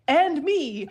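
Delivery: mother correcting the bride's sister mid-toast, piping up; piped up. Retold or imitated. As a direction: call out a correction from the back of the room. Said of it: "piped up".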